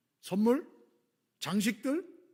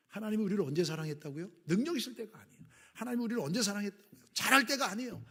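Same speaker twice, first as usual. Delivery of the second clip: rising, louder preacher questions; preaching; 5 semitones higher, 5 dB louder than usual. Acted. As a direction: drive the question home to the congregation. Preacher building call and answer.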